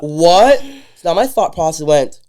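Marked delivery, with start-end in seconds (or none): 0.0-0.6 high, loud disbelieving cry of "What?"; shocked what. Retold or imitated. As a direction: yelp "What?" in stunned disbelief. Checking the trading app.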